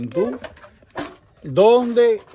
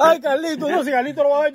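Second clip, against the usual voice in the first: laughing; 10 semitones higher, 5 dB louder, faster than usual. Real, not acted. Teasing about cousin Carlito.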